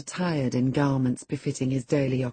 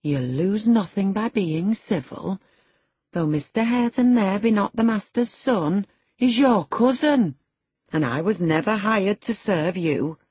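raised, lilting reproof; reproving servant woman; 6 semitones higher, 4 dB louder than usual. A narrator's reading. Servant correcting the haughty girl, reproving voice. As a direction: put the young miss in her place.

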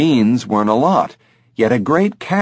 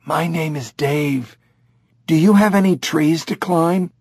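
put-on higher childlike chimp voice; eager chimp; 6 semitones higher than usual. Dave asks Jamie about trees, higher chimp voice.

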